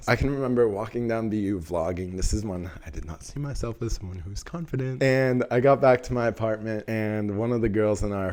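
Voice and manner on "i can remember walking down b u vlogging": ordinary talk, nothing marked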